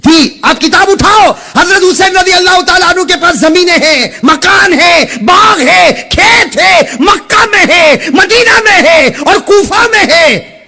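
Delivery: high, shouted list with drawn-out words; fiery preaching. Acted. Preacher proving to the crowd that Husain had wealth, fiery preaching. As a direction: hammer out each item slowly at full voice.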